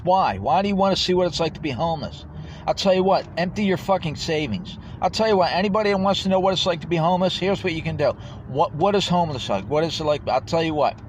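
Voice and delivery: high, loud, indignant rant; angry challenge. Real, not acted. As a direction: fire it at them as a furious dare. Angry challenge to homelessness tourists.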